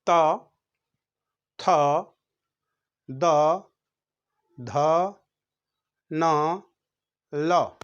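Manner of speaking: त, थ, द, ध, न, ल with the tongue against the teeth; tongue tip on the teeth for त थ द ध; one-by-one dental drill; dental letters demo. Shown as a demonstration. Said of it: "दांत पर जीभ लगने से होता है".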